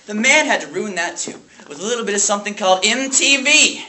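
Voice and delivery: high, loud, indignant rant; Dewey Finn. Acted. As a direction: build outrage to the final letters. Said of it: "lash out his rage at the small private school".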